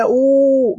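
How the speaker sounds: "أو" as a drawn-out ooo; madd with waw. Shown as a demonstration. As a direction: demonstrate "أو" held long. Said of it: "إذا جاك المد كذا". ("أو" بمدّ الواو)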